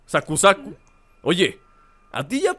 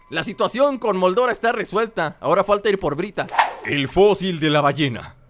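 usual speaking voice

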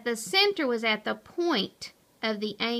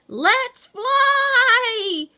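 high, loud, drawn-out shout; exuberant holler; 13 semitones higher, 10 dB louder than usual. A narrator's reading. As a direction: holler it as you take off. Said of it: "he hollered".

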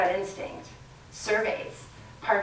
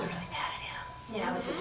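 whispered; 3 semitones higher, 11 dB quieter than usual. Real, not acted. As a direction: whisper it as a mock confession. Confiding mock grievance.

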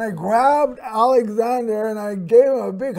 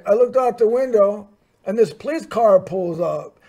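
usual speaking voice

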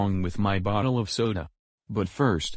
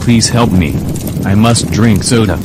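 loud desperate plea for help; desperate shout; 14 dB louder than usual. Acted. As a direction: shout like your mouth is on fire. Begging for soda, mouth burning.

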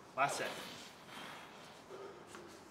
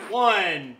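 loud counting; 8 semitones higher, 22 dB louder, much slower than usual. Real, not acted. Counting reps under strain.

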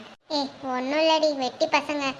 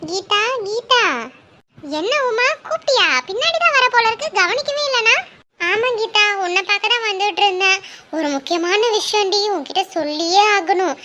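higher, louder auntie hailing her friend; village woman calling out; 4 semitones higher, 3 dB louder than usual. Acted. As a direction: call across the street, pitched up. Village women calling each other. (உயர்ந்த குரலில் கூப்பிடும் கிராமத்துப் பெண்)

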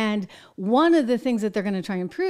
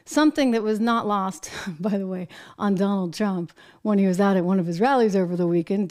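ordinary talk

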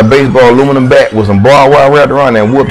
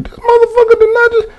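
high, squeaky mock outrage, cut off mid-sentence; stunned disbelief. Wrestler after the headbutt, squeaking in disbelief. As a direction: squeal it like you can't believe he did that. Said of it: "I was like".

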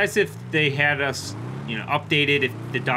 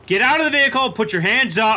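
loud, higher shouted orders to a driver; police commands; 8 semitones higher, 5 dB louder than usual. Acted. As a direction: bark it like a cop at a traffic stop. Officer orders suspect out of car.

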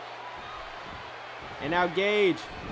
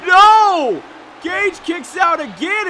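excited shout; 10 semitones higher, 11 dB louder, slower than usual. Real, not acted. Near fall in the match.

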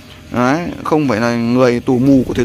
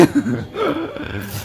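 high squeal of laughter; 13 semitones higher, 9 dB quieter than usual. Real, not acted. Laughs at brother eating fish.

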